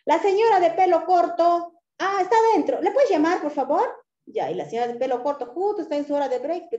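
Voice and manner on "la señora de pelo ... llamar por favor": high, loud insistent customer; customer asking. Retold or imitated. Customer asks staff to call her clerk, high and loud. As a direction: ask for her clerk, raised and pleading.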